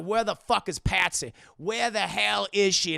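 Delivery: loud furious demanding; angry demand. Retold or imitated. Master yells at his slaves for Patsy, angry.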